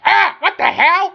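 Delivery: high, shouted robot yelp; startled robot. Acted. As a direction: squeal it out like a shocked little robot. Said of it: "the little robot goes".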